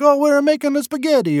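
put-on high sing-song Italian grandma accent; mock Italian nonna accent, lilting. Old Italian grandma answering her grandson, Italian accent. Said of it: "sorry, my accent is terrible".

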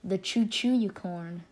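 ordinary talk

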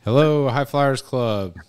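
slow, drawn-out radio host greeting; radio contest promo. Pretend. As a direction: announce it like a DJ on air. Hosts a radio call-in contest.

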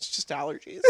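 whispered "just allergies"; sheepish whisper. Retold or imitated. Caught crying in church, whispering an excuse. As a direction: hide the tears under your breath. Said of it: "And I go".

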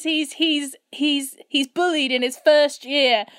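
usual speaking voice

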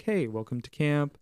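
normal talking voice

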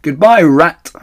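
loud, high cheeky farewell; calling out. Acted. The foxes call goodbye to Rat, loud and cheeky. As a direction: call it over your shoulder. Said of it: "they called out".

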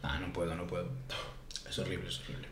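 can't-stand-it moan, dropped low and soft; exasperated groan. Real, not acted. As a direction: sink into a low, quiet groan of disgust. Groaning at the news.

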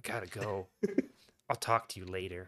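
soft, mock-seductive goodbye; fake sexy voice. Ex brushing off Scott, mock fake sexy voice. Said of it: "fake sexy voice".

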